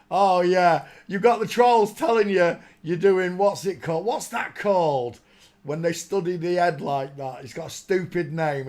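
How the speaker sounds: annoyed loud rant. Real, not acted. Ranting about trolls.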